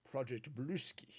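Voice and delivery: slow, heavy Russian accent on Bluski. Shown as a demonstration. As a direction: say it like a Russian would. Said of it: "talk about it in a Russian accent".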